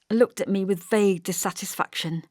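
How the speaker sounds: ordinary delivery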